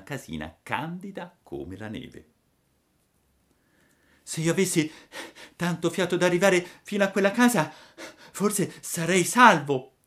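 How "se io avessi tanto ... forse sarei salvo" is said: high, urgent puppet voice; puppet's inner wish. A narrator's reading. Fleeing puppet talks to himself, urgent wish. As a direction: think aloud in a high, anxious rush. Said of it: "disse dentro di sé".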